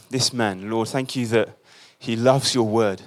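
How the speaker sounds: ordinary talk, nothing marked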